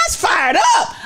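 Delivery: fired up. Real, not acted. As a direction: shout the excitement out.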